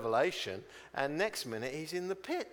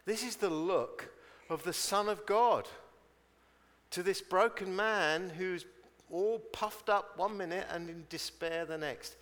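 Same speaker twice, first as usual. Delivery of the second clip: ordinary talk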